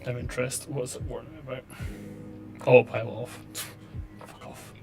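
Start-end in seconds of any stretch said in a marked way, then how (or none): 2.6-3.4 loud irritated snap at the warning; annoyed outburst. Real, not acted. 4.2-4.7 under-breath whispered swear; whispered curse. Real, not acted. Cursing at the warning.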